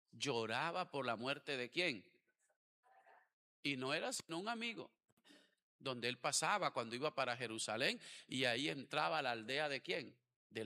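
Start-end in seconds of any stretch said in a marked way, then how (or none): none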